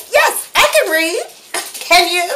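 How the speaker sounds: put-on high, loud indignant little girl voice; indignant five-year-old. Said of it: "arched back my little five-year-old shoulders and said".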